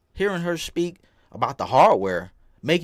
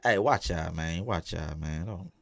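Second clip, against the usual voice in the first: much lower, quieter goofy voice; deep joke voice; 9 semitones lower, 4 dB quieter than usual. Pretend. Streamer answering chat in a low goofy joke voice. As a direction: drop into a low put-on voice for laughs. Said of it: "You funny".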